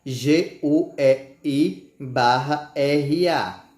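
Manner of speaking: slow letter-by-letter spelling. Shown as a demonstration. Teacher spelling fogueira slowly.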